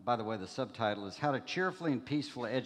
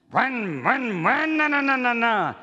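high, loud mock engine roar in rising bursts; a preacher imitating a chainsaw starting up; chainsaw noise imitation; 12 semitones higher, 11 dB louder than usual. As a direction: belt out a revving chainsaw sound. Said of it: "What's that noise?".

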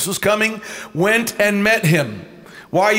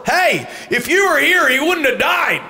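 higher, raised-voice rebuke; mock confrontation; 8 semitones higher than usual. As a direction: act out calling someone to account. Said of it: "Jesus being confronted by Martha".

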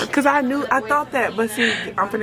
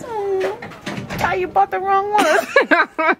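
teasing; 10 semitones higher, much slower than usual. Real, not acted. Teasing friend about wrong edition.

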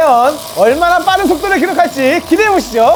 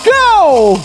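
fighting game announcer; much slower than usual. Acted. Starting the race again.

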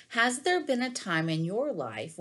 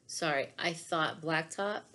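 usual speaking voice

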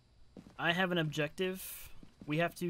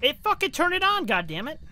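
startled outburst; 10 semitones higher, 7 dB louder than usual. Real, not acted. Spirit box startles him.

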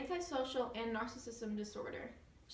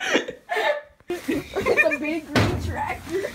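very loud, high, slow and laughing; 7 semitones higher, 16 dB louder, slower than usual. Real, not acted. Actor cracking up, laughing loud and slow.

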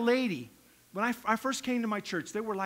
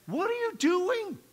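higher, slow disbelieving challenge; incredulous rebuke; 9 semitones higher, 3 dB louder than usual. Retold or imitated. Head elder takes the greeter aside, higher and incredulous. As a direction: demand an explanation.

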